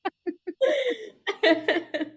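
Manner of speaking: a high, real burst of laughter; high giggle. Real, not acted. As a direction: giggle high and free at your own joke. Laughing at her own joke.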